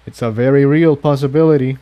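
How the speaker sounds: ordinary delivery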